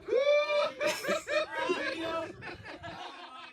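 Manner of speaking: shrieking burst of laughter; high squealing laugh. Real, not acted. Squealing laugh after the joke.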